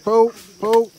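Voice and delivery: loud straining pull; tug of war. Real, not acted. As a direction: heave while shouting. Pulling child out of mud.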